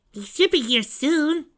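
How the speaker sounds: put-on high, loud, slow kid voice; eager young kid sidekick. A narrator's reading. Tails waiting outside Sanctuary with the grooms, eager. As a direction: pitch it way up like an eager little brother.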